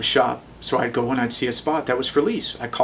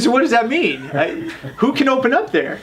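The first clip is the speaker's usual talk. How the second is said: high, disbelieving protest; incredulous; 10 semitones higher than usual. Retold or imitated. He protests the parking demand to the city, incredulous.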